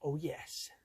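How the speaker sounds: whispering. Real, not acted. Quiet night, fish waiting in net.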